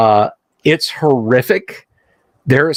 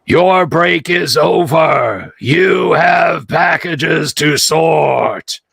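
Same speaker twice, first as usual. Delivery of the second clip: slow rasping robot voice, stuck looping; metallic voice filter; 3 semitones higher, slower than usual. Acted. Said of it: "a rasping metallic voice says".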